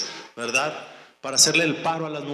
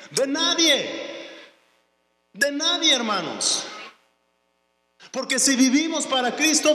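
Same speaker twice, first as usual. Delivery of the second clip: fiery preaching; 6 semitones higher than usual. Acted. Pastor declaring faith to the church at full force. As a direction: preach it out with rising fire.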